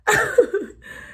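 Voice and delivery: bright burst of laughter up high; short high giggle. Real, not acted. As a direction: let a laugh pop out high. Laughs after fixing hair.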